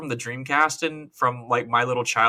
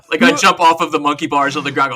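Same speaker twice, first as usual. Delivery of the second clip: usual speaking voice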